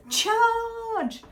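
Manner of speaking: high, drawn-out charge call; playful rallying yell; battle cry. Acted. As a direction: cry it out like a jouster. Acts out a jousting battle cry.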